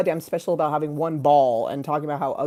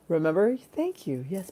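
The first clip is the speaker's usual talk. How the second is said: ordinary talk, nothing marked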